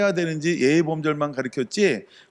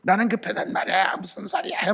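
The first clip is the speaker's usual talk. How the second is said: impatient complaint; 4 semitones higher than usual. Acted. Impatient believer voiced in sermon.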